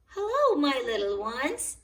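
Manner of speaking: high, loud grandmotherly hello; fond Granny. A narrator's reading. Granny Pig greets the children, high fond hello. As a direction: welcome the little ones with open arms. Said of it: "answered Granny Pig".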